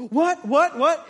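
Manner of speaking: high, loud, questioning exclamations; startled disbelief. Acted. Man in the crowd hearing gasps, startled disbelief.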